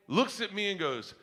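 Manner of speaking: ordinary talk, nothing marked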